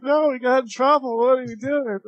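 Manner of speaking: high-pitched retold alarm; mock panicked protest. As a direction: imitate a kid fretting about being in trouble.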